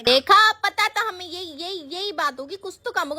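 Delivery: we knew it all along; told-you-so. Acted. Student sees through the teacher.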